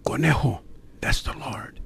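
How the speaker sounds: hushed whisper, barely any voice; soft voiceless whisper of reassurance. Retold or imitated. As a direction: whisper it close to his ear, gently. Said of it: "what Joe Lugo was saying in a whisper".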